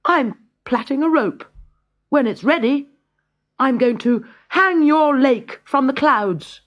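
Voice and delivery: put-on a brave boy calling up to a giant; high young hero, daring and sure; bold boy. A narrator's reading. Boy Avram's voice.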